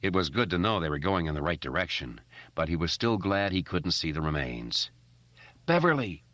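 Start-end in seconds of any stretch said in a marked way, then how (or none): 5.7-6.2 high, loud call; shouting. A narrator's reading. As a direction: yell her name into the dark. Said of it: "Ben shouted".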